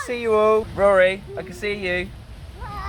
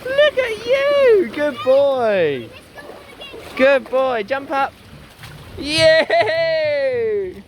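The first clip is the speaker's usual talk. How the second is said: squeaky praising a dog; much slower than usual. Real, not acted.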